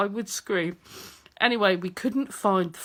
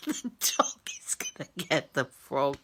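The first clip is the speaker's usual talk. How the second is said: soft whisper, much lower and breaking into laughter; whispered through giggles; 9 semitones lower, 7 dB quieter than usual. Real, not acted. Wife repeating her worry about the dog, whispered through giggles. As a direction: whisper it while trying not to laugh.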